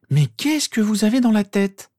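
high, loud angry outburst; furious. A narrator's reading. Mother furious at the silent boys, shouting high. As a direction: berate them at a high pitch. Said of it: "demanda-t-elle furieuse".

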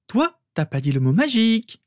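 high-pitched teasing comeback; taunting little-sister voice; sister's retort. A narrator's reading. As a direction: toss back a pert, high retort. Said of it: "lui rétorqua sa sœur".

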